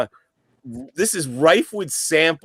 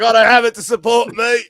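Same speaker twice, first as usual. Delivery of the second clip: higher, louder put-on British crank caller; mock British; 7 semitones higher, 9 dB louder than usual. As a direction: ham up a cockney prank call. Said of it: "he goes like".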